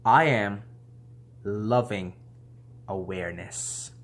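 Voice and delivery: slow, dropping mantra; meditative chant-like recitation; slow affirmation. Shown as a demonstration. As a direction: intone it slowly, fading at the end. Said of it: "the affirmation slash incantation is".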